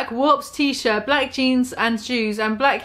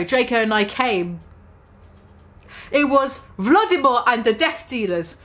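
ordinary delivery

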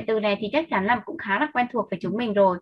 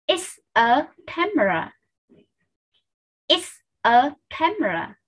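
slow, each word set apart; careful word-by-word demonstration; slow model sentence; 4 semitones higher, slower than usual. Shown as a demonstration. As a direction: space out the words for learners to copy. Teacher models sentence for repetition.

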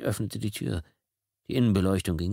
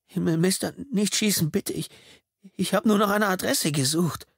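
put-on high, pleading young voice; delivery boy pleading in panic; terrified teen; 11 semitones higher than usual. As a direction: beg not to be shot.

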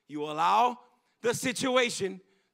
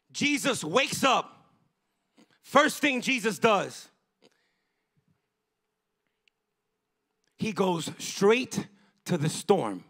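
slow dramatic telling; slower than usual. Acted. Dramatic retelling of Jesus.

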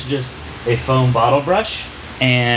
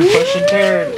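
sing-song coaxing; 4 dB louder, slower than usual. Real, not acted. Cheering child on at therapy.

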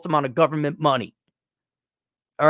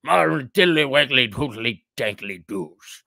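lower, slow nonsense voice; mock silly voice; 5 semitones lower, slower than usual. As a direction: babble like a cartoon fop. Nonsense mockery of Brits.

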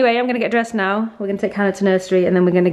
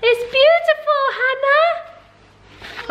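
much higher, sing-song praise; 12 semitones higher, slower than usual. Real, not acted. Praising daughter's necklace.

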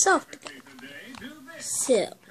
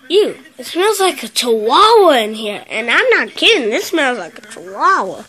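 disgusted; 9 dB louder than usual. Real, not acted. Disgusted at box smell.

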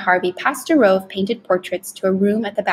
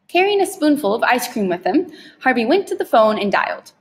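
ordinary delivery

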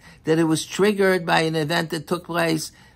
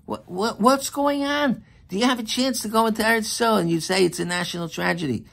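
incredulous outcry; 6 semitones higher than usual. Acted. Preacher protesting the people's weeping, incredulous. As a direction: cry out in disbelief.

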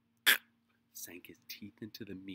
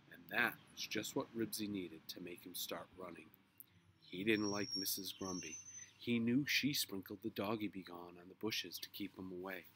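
ordinary delivery, nothing marked

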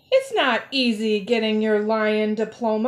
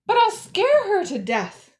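high, louder objection; alarmed protest; 9 semitones higher, 5 dB louder than usual. A narrator's reading. Pupil objects to lion teacher's plan, high alarmed protest.

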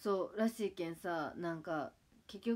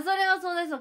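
higher and louder burst of agreement; emphatic agreement; 9 semitones higher, 9 dB louder, faster than usual. Real, not acted. Agreeing with a viewer's story, loud emphatic agreement. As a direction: land it as an obvious truth.

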